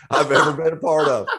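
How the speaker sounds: laughing through the words. Real, not acted. Laughing while praising the interview.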